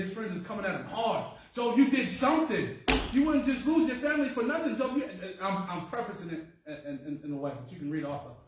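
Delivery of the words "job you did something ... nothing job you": accusing friend. Acted.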